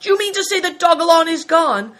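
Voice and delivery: high, loud incredulous question; outraged alarm; alarmed cry. A narrator's reading. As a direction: demand it in shocked disbelief. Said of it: "cried Greencoat".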